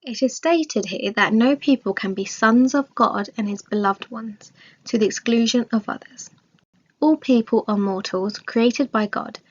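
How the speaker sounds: ordinary talk, nothing marked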